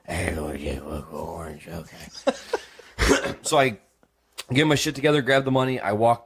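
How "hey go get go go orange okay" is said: softer, breathy hushed reply; whisper. Retold or imitated. Telling the roommate to go orange in a whisper. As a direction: drop to a secret whisper. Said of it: "I was actually like".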